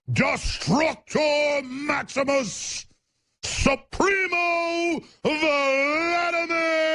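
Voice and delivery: rising sarcastic proclamation, slow and high; mock epic announcement of Putin's titles; mock grandiose. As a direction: build up the titles like a ringmaster mocking. Mocking grand build-up of Putin titles.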